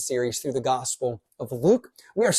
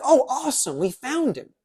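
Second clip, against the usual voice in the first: much higher, bright cheer of relief; excited relief; 9 semitones higher than usual. Pretend. Older brother imagined cheering his brother's return, excited. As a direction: celebrate the found brother with a big happy lift. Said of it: "older brother would be like".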